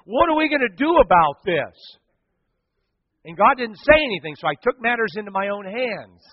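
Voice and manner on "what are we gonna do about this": loud, higher frustrated cry to God; angry plea. Retold or imitated. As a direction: demand an answer from God. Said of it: "stoplight going".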